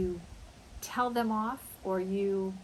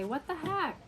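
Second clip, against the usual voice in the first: baffled annoyance; 5 semitones higher than usual. Acted. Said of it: "Maybe your T V's not working".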